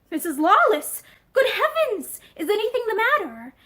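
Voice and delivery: sudden high alarmed outcry; shocked to see her there; startled alarm. Acted. Alarmed man sees her.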